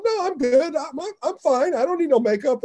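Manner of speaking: high whiny retold refusal; playing his younger self pushing back; mock protest. Refusing the makeup lady's offer, in a high mock whine.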